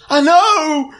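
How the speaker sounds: very high acted cry; high-pitched frantic exclamation; panicked guard. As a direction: yelp it in mock panic. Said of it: "He goes".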